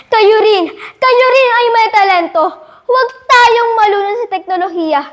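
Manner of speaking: high, insistent oratorical appeal; impassioned call to fellow youth; rousing exhortation. Acted.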